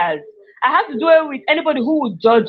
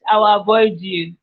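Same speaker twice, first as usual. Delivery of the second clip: ordinary talk, nothing marked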